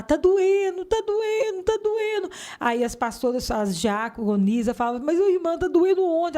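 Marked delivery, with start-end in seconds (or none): 0.0-2.3 high-pitched repeated wail; retold cry of pain. Woman re-enacting her agony at the retreat, crying out. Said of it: "aí eu começava a gritar". 5.0-6.4 higher-pitched, concerned query; retold worried question. Deaconesses asking the woman where it hurts, worried. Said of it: "Elas ficavam preocupadas".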